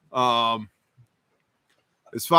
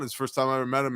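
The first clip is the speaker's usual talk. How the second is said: ordinary talk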